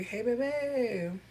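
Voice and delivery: slow, drawn-out fond hello; sweet greeting. Real, not acted. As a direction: stretch it out lovingly. Greeting a viewer fondly.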